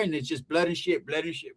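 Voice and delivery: aunt's voice fading to hush. Retold or imitated. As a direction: let it fade away quietly. Aunt trailing off.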